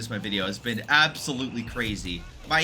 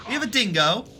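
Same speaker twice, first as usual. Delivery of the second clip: a rough attempt at an Australian accent on "dingo", pitched higher; 8 semitones higher, 3 dB louder than usual. Shown as a demonstration. Caster announcing the dingo's arrival in a hammy Australian accent. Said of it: "I'm butchering that accent".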